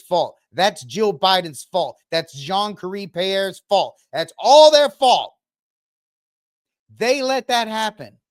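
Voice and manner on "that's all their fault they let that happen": sudden louder, higher outburst; loud blame. Real, not acted. Ranting at viewers, a loud, high accusing burst. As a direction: punch the blame out hard and high.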